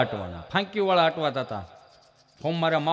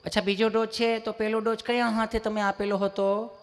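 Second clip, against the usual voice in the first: higher, gentle nurse voice; sweet nurse; 3 semitones higher than usual. Retold or imitated. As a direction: ask kindly like a caring nurse. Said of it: "સહજતાથી પૂછતા હોય એમ જ પૂછે".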